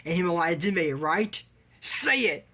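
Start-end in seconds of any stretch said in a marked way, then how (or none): 1.8-2.5 high, sharp order; bossy high-pitched command; mock command. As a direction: demand he say it. Retold command.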